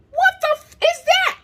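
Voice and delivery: high, loud broken outburst; re-enacted squeal of disbelief; horrified shock. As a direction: shriek at an alarming discovery. Discovering the tube under blanket.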